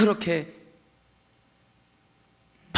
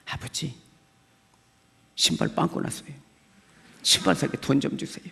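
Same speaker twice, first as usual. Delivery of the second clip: hushed, pleading whisper; earnest whispered prayer; 8 semitones lower, 6 dB quieter than usual. Retold or imitated. Child now praying earnestly for shoes, whispered. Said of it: "정말 간절하게 했습니다".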